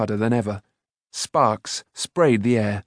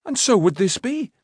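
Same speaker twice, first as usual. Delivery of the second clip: high, eager persuasion; urging; 10 semitones higher than usual. A narrator's reading. Boy talking the smith round, urging. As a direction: coax him eagerly, pitch up. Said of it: "Taran urged".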